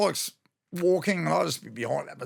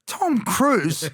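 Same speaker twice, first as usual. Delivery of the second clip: much higher, startled "Tom Cruise?"; a starstruck double take; surprised; 8 semitones higher, 3 dB louder than usual. Retold or imitated. Spotting Tom Cruise walk in, high surprised question. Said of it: "And I went".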